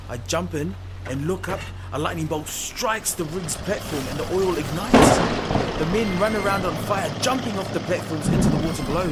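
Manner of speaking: loud, driving storm narration over music; dramatic action narration. A narrator's reading. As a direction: sweep them into the chaos. Action climax narrated loudly.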